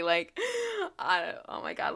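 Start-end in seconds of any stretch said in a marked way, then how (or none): none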